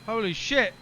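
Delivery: loud shocked outburst; shocked exclaim. Real, not acted. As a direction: blurt it out in shock. Plane goes haywire.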